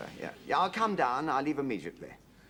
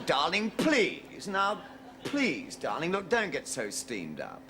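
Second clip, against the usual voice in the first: usual speaking voice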